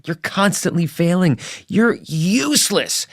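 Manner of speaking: a hint of a harsh drill sergeant; inner drill sergeant. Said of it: "very ferocious drill sergeant who would berate me".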